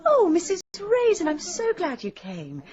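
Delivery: high, gushing welcome; effusive greeting. Acted. Hostess welcoming a guest to the ladies' society, effusive greeting. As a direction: start high and loud, then settle slowly.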